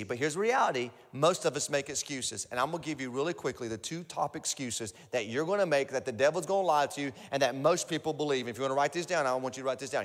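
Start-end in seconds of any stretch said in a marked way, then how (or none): none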